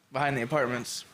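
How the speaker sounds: normal talking voice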